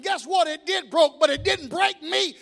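preacher climbing to a triumphant shout; preaching at full force. Acted. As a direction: build the testimony to a roar. Preaching builds to climax.

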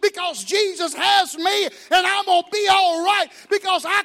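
preacher climbing to a triumphant shout; preaching at full force. Acted. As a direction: build the testimony to a roar. Preaching builds to climax.

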